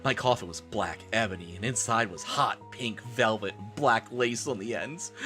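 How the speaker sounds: ordinary delivery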